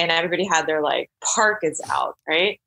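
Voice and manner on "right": ordinary delivery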